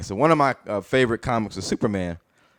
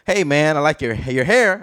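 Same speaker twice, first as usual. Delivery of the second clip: a hint of a little boy, higher and slower; slow, higher child's compliment; lightly voiced young son; 5 semitones higher, slower than usual. Son compliments his dad, lightly voiced little boy. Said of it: "he'll compliment me. He'll go".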